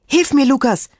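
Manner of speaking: aufgeregter, hoher Hilferuf; Schreien. A narrator's reading. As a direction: um Hilfe schreien, deutlich höher. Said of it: "schrie Antonella". (aufgeregt schreien)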